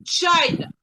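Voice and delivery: "China" with a high, strong CHI and falling end; stress on first syllable. Shown as a demonstration. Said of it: "a primeira sílaba vai ser a sílaba mais forte". ("China" com a primeira sílaba alta e forte)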